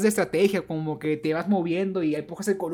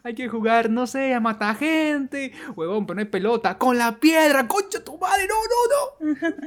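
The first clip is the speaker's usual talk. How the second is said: put-on high, loud childish voice; excited kid voice; 8 semitones higher, 5 dB louder than usual. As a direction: play the kids arguing excitedly. Kids' game voices acted out.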